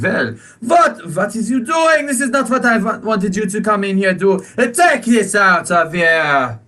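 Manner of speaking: high, hammed-up French accent; a shopkeeper fussing in a mock French accent. Shopkeeper scolding the party in a hammed-up French accent. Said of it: "does a more French accent".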